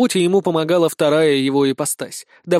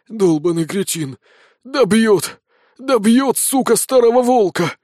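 panicked cursing; 6 semitones higher, 4 dB louder, slower than usual. Acted. Panicked old wolf fleeing.

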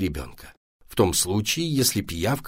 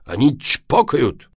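louder, slow and emphatic; angry; 5 semitones higher, 5 dB louder, slower than usual. A narrator's reading. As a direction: stretch it out crossly. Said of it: "сердито сказал папа".

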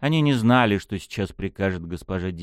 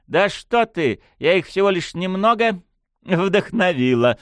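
high, playful false innocence; sly drawl; 10 semitones higher, 3 dB louder than usual. A narrator's reading. Unseen lady denies meddling with the youngsters, sly drawl. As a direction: feign innocence with sly amusement. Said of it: "лукаво протянул голос Дмитриевой".

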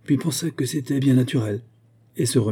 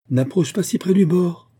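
high, loud warning call; crying out; 9 semitones higher, 5 dB louder than usual. A narrator's reading. Olga to her friend at the cliff edge, crying out. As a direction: call her back from danger, pitch jumping up. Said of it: "lui cria Olga".

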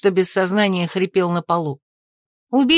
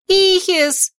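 high-pitched cry of a name; shrill cry; 11 semitones higher than usual. A narrator's reading. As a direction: call the name out high and frightened. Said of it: "взвизгнула я".

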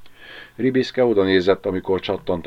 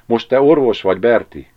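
louder, lifted pep talk to himself; self-encouragement; 4 semitones higher, 6 dB louder than usual. A narrator's reading. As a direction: urge yourself on, mildly. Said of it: "biztatta magát". (biztatva, magának mondva)

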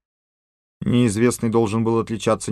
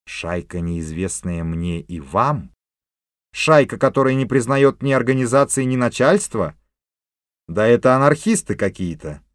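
rising indignant disbelief; indignant outburst; 3 semitones higher, 3 dB louder than usual. Acted. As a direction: build from doubt to outrage. Chief voices indignation.

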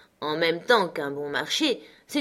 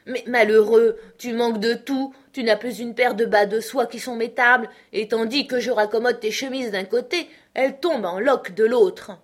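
raised, insistent reproach; scolding grandmother; 5 semitones higher, 5 dB louder than usual. A narrator's reading. Grandmother scolding Benjamin over his worn clothes, indignant reproach. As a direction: berate him with a forceful opening.

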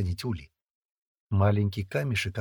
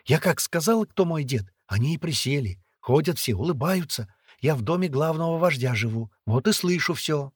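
put-on higher, lively girl's voice; chattering young girl; 6 semitones higher, 4 dB louder than usual. A narrator's reading. Girl telling captive boy of ransom, chattering. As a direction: reassure him in a pitched-up chatter.

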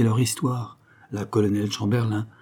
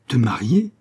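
taken aback, rising sharply at the end; shocked question; 3 semitones higher, 4 dB louder than usual. A narrator's reading. Mother hears of a marriage plan for her daughter, shocked question. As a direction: voice jumping up on an astonished question.

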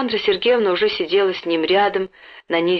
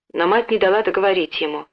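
usual speaking voice

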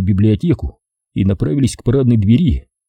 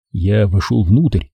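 plain reading voice

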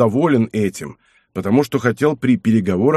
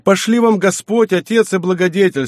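high, effusive blessing; acted exclamations, pitched well up; exclaiming; 7 semitones higher, 3 dB louder than usual. A narrator's reading. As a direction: gush thanks to a benefactor. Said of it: "стал восклицать Мацко".

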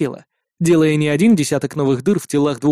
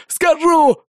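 yelling; 13 semitones higher than usual. Acted. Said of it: "завопил он".

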